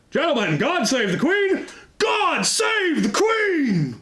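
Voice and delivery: high, ringing battle cry, slow and drawn out; the pike block roaring at the Queen's procession; rousing shout. Retold or imitated. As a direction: hit them with a proper rally cry. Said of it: "we hit them with a proper".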